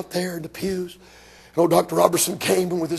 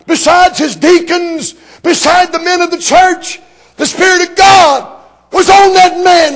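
high, loud, hammering revival preacher; preacher rising to full shouting fervor; fiery old-time preaching; 11 semitones higher, 12 dB louder than usual. Retold or imitated. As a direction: shout it like a hellfire preacher from the pulpit.

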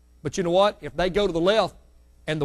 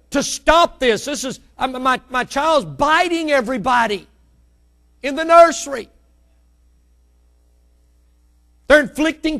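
loud, high pleading cry to God; desperate prayer; 7 semitones higher, 8 dB louder than usual. Retold or imitated. Father crying out to God about his biting child, high loud plea. Praying aloud in retold desperation.

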